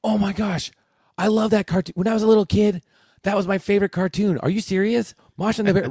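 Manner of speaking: put-on high, gushing excited kid voice; high-pitched excited students. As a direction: gush like a thrilled former fan. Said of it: "They're like".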